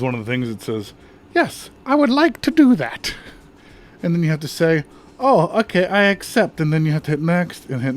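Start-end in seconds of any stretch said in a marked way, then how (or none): 1.3-3.1 silly high voice. Pretend. Voicing the on-screen prompt. 5.2-6.6 silly high voice. Pretend. Voicing the on-screen prompt.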